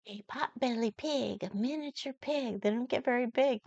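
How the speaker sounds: ordinary delivery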